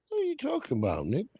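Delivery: high, soft disbelieving question; can't believe what you just read; incredulous. Real, not acted. Streamer answering a chat comment in high disbelief.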